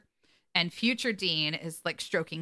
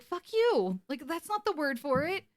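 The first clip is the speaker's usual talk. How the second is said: exasperated; 8 semitones higher than usual. Retold or imitated. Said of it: "Dean's like".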